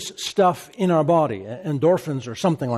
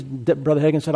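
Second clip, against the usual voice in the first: ordinary delivery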